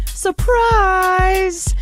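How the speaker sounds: high bright surprised exclamation; spring the surprise on them; mock surprise.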